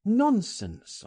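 high-pitched, emphatic dismissal; crying out. A narrator's reading. Friend dismissing fears of the police, exclaiming. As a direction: brush the fear aside sharply. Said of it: "I cried".

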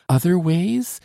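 high, loud startled exclamation; exclaimed. A narrator's reading. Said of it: "Exclaimed Solange".

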